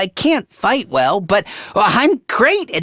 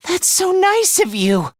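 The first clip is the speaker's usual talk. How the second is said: overjoyed gratitude; 9 semitones higher than usual. Acted. Overjoyed at being talked to again.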